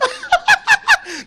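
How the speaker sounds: very high squealing giggle. Real, not acted. Speaker laughing at himself, very high squealing giggle. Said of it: "don't laugh at my laugh".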